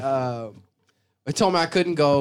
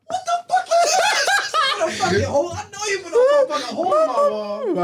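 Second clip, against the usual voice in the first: mock shocked mom yelling, much higher; imitating furious mom. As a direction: play the mom yelling at her kid, hammed up. Mom discovering the hole.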